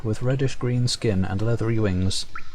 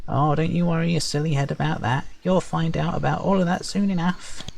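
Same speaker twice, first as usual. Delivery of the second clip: high, teasing sprite voice; perky high sprite; 7 semitones higher than usual. Acted. Sprite brushing off the player's question, perky and high. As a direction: chirp it brightly, high up. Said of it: "perky, high-pitched voice".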